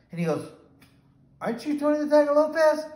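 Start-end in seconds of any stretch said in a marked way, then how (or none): 1.4-2.9 high, loud voice of startled recognition; surprised recognition. Retold or imitated. Walk-in customer recognizing Tony the Tiger Lopez, surprised and high-pitched. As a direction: imitate a customer excitedly spotting a celebrity.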